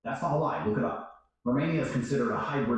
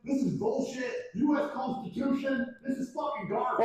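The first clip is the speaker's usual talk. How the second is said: high-pitched, outraged disbelief building to a shout; pitched-up furious rant; exasperated rant; 11 semitones higher than usual. Retold or imitated. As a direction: vent at the embassy like they betrayed you. Ranting at the American embassy.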